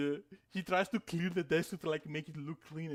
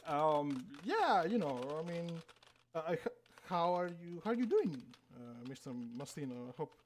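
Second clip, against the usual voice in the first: halting small talk with stumbling restarts; lightly acted unease, trailing into a hesitant question; nervous employee. Employee checking on Mister Mastino, mildly nervous.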